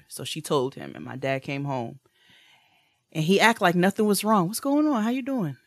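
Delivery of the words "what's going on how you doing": high breezy greeting, overly casual; fake-casual dad. Dad pretending nothing's wrong to daughter, high breezy tone.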